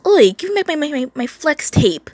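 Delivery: high, loud squawked demand; obnoxious shriek. A narrator's reading. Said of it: "was shrieking obnoxiously".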